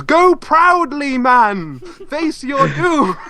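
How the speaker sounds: slow, grandly drawn-out battle cry; mock-heroic send-off.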